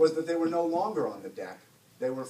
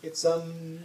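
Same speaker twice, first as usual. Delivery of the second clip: stalling with an um; hesitate, unsure where to begin; hesitant; 3 semitones higher than usual. A narrator's reading. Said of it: "J D hesitated".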